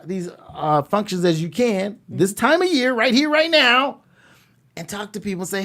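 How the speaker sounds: ordinary talk, nothing marked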